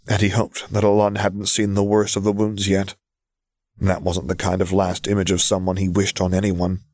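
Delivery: ordinary delivery, nothing marked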